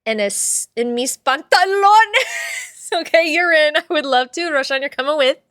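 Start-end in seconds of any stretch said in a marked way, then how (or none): 0.8-2.7 en mis pantalones, slow and higher; mock Spanish phrase. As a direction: show off a silly Spanish line. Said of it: "Tengo un gato".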